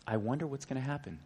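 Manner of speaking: quietly voiced retold thought; hushed inner thought. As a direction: wonder it quietly, as if privately thinking.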